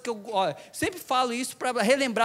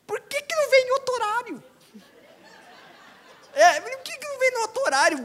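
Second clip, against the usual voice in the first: much higher, put-on whiny voice; mock complainer. Preacher imitating someone complaining about the elderly, high whiny voice. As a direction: whine like an impatient person in line. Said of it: "e a pessoa começa".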